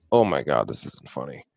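low, dropped-voice dismay; deadpan groan of disappointment; disappointed realization. Retold or imitated.

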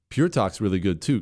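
ordinary talk, nothing marked

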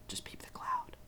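breathy secret whisper. Pretend. Whispered punchline.

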